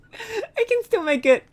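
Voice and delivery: high, laughing disbelief. Real, not acted. Streamer reassuring herself while giggling nervously. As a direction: giggle it out, half not believing it.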